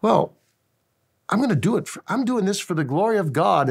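light up as you explain your purpose; eager worker. Retold or imitated. Worker explains his joy.

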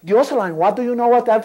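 high, loud broken English; English-speaking first lady. Retold or imitated. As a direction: mimic a posh lady in English. Imita a la señora Carolina en inglés. (imitación alta en inglés de la señora Carolina)

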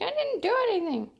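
playing innocent to the cat; mock innocent. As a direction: sound falsely blameless. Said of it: "I'm like".

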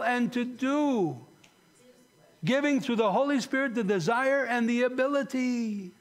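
high, ringing sermon delivery; impassioned preaching. Real, not acted. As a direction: preach it with rising fervor, stretching the key words. Preacher builds to climax.

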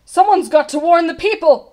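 high, loud call to action; urgent cry. A narrator's reading. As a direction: cry out with alarm. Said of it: "cried Bartholomew".

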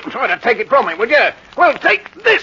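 loud threat climbing high; violent fury. Acted. Henry stabbing Albert over the knife, in rising fury. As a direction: build from a dare to a furious cry.

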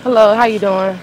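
ordinary talk, nothing marked

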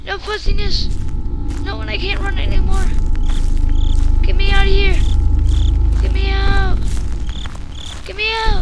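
loud desperate begging; panicked pleading. Real, not acted. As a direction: yell to be let out. Pleading to escape.

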